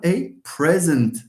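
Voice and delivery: "present" stressed on the first syllable. Shown as a demonstration. Teacher giving the noun example, first-syllable stress on "present". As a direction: punch the start of "present", as in a gift. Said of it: "when we have a verb".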